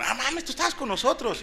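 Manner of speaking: put-on high, surprised voice of an old classmate; a put-on "oh wow, you were with us"; mock surprise. Imita a excompañeros. (sorpresa fingida, aguda)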